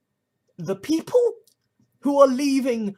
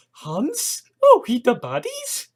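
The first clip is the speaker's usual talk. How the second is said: high, dawning mock surprise; mock realisation; 6 semitones higher, 5 dB louder, slower than usual. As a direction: let it dawn on you, jumping up high. Sudden realisation, Mitchell-and-Webb quote.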